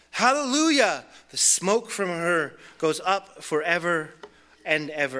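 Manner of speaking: loud hallelujah. Acted. Multitude crying out again to God, loud jubilant cry. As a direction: ring it out in triumph. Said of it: "Once more they cried out".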